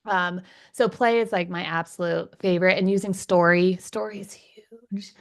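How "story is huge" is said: soft whispered aside. Real, not acted. Parenting coach whispering her key point about story. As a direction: lean in and whisper it like a secret.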